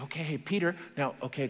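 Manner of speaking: hushed, patient prompting; lightly acted guiding angel; gentle coaxing. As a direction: softly coax someone half-asleep. Angel coaxing a drowsy Peter.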